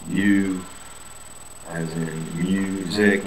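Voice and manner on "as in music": as in music, spoken very slowly; teacher modelling the U in music; slow vowel demo. Shown as a demonstration. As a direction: stretch the you sound in music. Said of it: "here I give the Y U".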